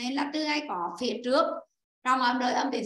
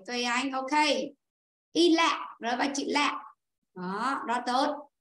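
normal talking voice

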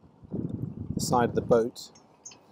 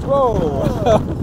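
a loud, high yelp of alarm; loud startled shout at the sudden wind; real startled whoa; 11 semitones higher, 11 dB louder than usual. Real, not acted. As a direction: cry out as the gust hits. Strong gust nearly blows kit.

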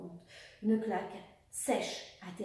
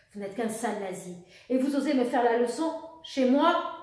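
restrained fury rising louder at the end; contained fury; 2 semitones higher, 8 dB louder than usual. A narrator's reading. Baroness rebukes the officer in her home, contained fury. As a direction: hold back the rage, then let it rise. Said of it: "avec une fureur contenue".

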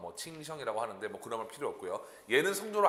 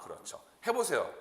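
ordinary talk

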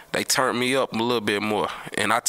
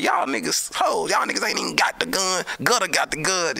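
bragging friend; 5 semitones higher than usual. Retold or imitated. Fat bragging to homeboys.

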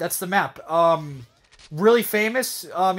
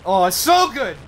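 excited; 5 semitones higher, 6 dB louder, slower than usual. Real, not acted. Delight at a kill.

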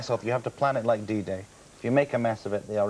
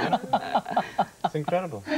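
usual speaking voice

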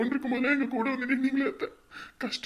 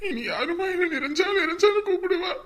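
sobbing; 6 semitones higher than usual. Acted. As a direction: wail the question through tears. Son weeping at mother's body.

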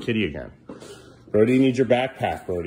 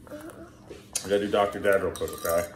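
normal talking voice

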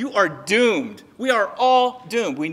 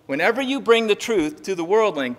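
usual speaking voice

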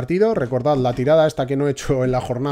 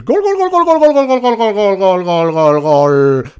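shouted, much higher and louder goal call; goal commentary; 10 semitones higher, 9 dB louder, slower than usual. Pretend. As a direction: scream the goal, then let it fade.

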